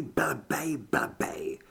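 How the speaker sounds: the curse sinking to a whisper; fading whisper. A narrator's reading. Zed cursing at his bitten arm, fading to a whisper. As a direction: let the swearing die into breath.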